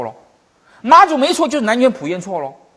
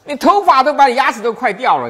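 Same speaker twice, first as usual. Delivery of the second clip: much higher and louder rebuke; harsh scolding; 7 semitones higher, 7 dB louder than usual. Retold or imitated. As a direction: tear into him, the old fool. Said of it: "就把他骂一顿".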